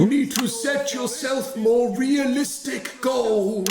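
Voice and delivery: octopus voice. Acted. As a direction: boom it as the wise octopus. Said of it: "says the octopus".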